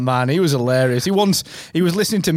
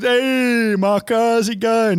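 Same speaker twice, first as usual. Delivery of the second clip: higher, slow put-on cheery greeting; imitating Don; 8 semitones higher, much slower than usual. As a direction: greet him like your eccentric old workmate. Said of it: "He was, he was".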